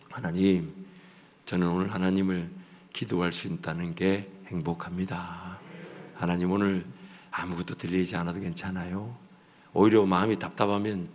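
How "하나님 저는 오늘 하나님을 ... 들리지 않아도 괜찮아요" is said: low, soft and tender; tender hushed prayer; quiet contented prayer. Shown as a demonstration. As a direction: pray it gently, at rest.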